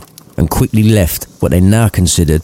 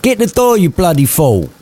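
higher barked order; bossy Scrooge; 10 semitones higher than usual. Acted. Scrooge ordering Cratchit to the door. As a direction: order him about rudely.

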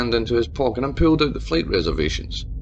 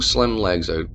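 ordinary delivery, nothing marked

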